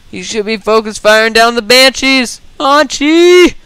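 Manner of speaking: rising, high-pitched shout at the player; exasperated yell. Real, not acted. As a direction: build to a high, frustrated yell of the name. Exasperated caster yelling at player.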